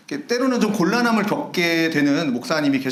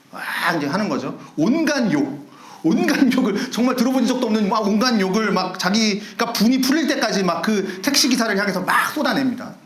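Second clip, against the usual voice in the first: ordinary talk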